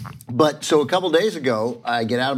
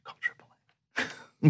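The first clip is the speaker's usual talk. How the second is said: muttered aside; 7 dB quieter than usual. Real, not acted. Incredulous repeat of neighbor's request.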